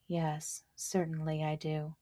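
put-on a quiet, calm nod of an old tree; old willow. Willow answering the buckwheat, soft and low.

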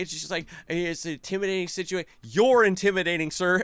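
hammed-up cowed pleading; mock intimidated stammer. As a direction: stumble into a panicky explanation. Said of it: "Why are you stuttering?".

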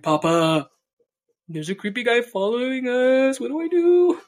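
high, loud frightened rising call; calling papa about the creepy guy, scared and high; panicked plea. Retold or imitated.

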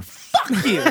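high, laughing fuck you; laughing curse. Retold or imitated. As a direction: blurt it while laughing.

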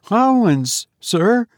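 high, frightened small answer; reply fearfully, pitched right up; trembling sexton. A narrator's reading. Sexton owning up to the goblin, frightened and high. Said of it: "trembling more than ever".

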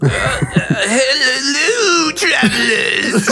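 very high, slow squeaky gnome. Acted. Greeting the party as the gnome, very high. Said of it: "doing the gnome voice".

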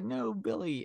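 high, drawn-out mock wail; hammed-up mourning cry; mock grief. Everyone crying out over buried Billy, mock grief.

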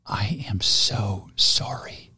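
slow, hushed, whispery apology; meek parrot saying sorry very softly; mock contrite. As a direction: whisper a drawn-out, acted apology. Frozen parrot apologizes in joke.

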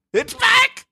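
very high squealed cheer; ecstatic. Real, not acted. Co-host celebrating the hallway's return, ecstatic. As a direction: shriek it high with delight.

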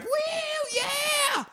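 high excited mock cheer; cheering crowd. Crowd cheering Sonny tipping the pizza guy, mock cheer. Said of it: "the crowd's like".

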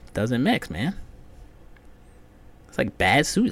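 ordinary delivery

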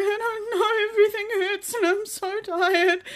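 high, teary whimper; mock crying whine. Exhausted worker answering a colleague in the van, high teary whine. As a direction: whimper it pitifully. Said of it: "And I was like".

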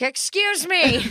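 slow, drawn-out polite call; mock polite excuse me. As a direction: stretch it out like chasing someone down. Friend acts her chasing him.

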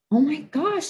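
high, startled imitation of dad; shocked father. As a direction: gasp it out like a dad seeing his daughter cry. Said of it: "my father was like".

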